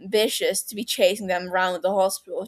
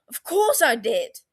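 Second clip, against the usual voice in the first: sharp, louder outburst; cry out; 5 semitones higher, 4 dB louder than usual. A narrator's reading. Sirius defending himself to Peter, crying out. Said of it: "Sirius cries".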